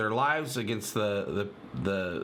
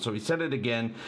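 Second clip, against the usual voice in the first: normal talking voice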